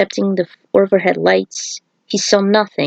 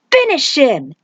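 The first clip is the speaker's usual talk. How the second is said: cried out; 6 semitones higher than usual. Acted. Stryker urging Cabal to finish Kano, raised cry. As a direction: call out the kill to Cabal, higher. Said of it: "Stryker cried".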